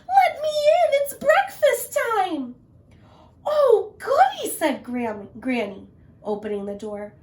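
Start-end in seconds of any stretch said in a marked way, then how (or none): 0.1-2.5 very high, loud squeaky plea; wolf pretending to be a girl; fake high voice. Wolf faking Little Red. 3.4-4.5 very high loud "oh goody"; old lady pleased at breakfast; delighted granny. Acted. Granny voiced.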